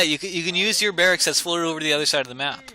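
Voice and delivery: ordinary talk, nothing marked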